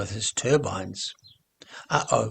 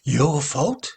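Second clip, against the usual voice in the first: higher and louder, rising into disbelief; horrified; 7 semitones higher, 5 dB louder than usual. Acted. Nelly horrified by the old man's confession, rising disbelief. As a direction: refuse to believe it, climbing in alarm. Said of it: "Nelly was horrified".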